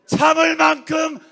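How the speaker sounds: high, forceful shout in two bursts; fed-up rallying shout. Real, not acted. Orator speaking for the people at a rally, fed-up shout. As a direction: shout it out as the final straw. Climactic rally declaration of exasperation.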